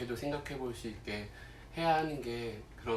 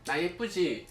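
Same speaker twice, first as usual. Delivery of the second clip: coy, higher flirty tease; teasing pepper voice; 6 semitones higher, 4 dB louder than usual. Acted. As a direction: playfully voice a tempting pepper. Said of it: "하면서". (새침하게 유혹하는 고추 목소리)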